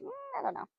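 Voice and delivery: high, soft, hesitant horse voice; a hesitant high little voice for the mare; voicing the horse. Trainer voices her mare's doubt in a high, soft voice. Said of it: "then she was like".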